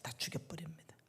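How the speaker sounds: hushed, breathy warning; a sudden whispered warning. Real, not acted. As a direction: drop to a whisper for the threat. Warning in a sermon.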